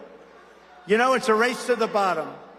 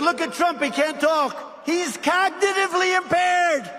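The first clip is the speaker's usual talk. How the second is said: mocking the press; 9 semitones higher than usual. Mocking the fake news that say he can't talk, high jeering shout. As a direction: sneer it like a gloating reporter. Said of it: "and they said".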